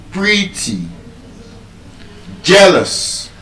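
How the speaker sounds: PRET-ty, JEAL-ous, each word set apart; first-syllable stress. Shown as a demonstration. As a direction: lift the first syllable of "pretty" and "jealous". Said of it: "be stressed on".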